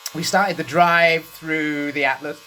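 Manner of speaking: ordinary talk, nothing marked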